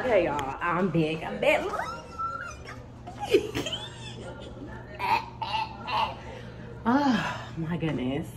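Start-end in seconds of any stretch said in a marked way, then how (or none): 1.7-6.8 high squeal of a laugh. Real, not acted. Vlogger squealing at her new phone, high excited laugh. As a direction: squeal with delight. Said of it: "excited over new phone".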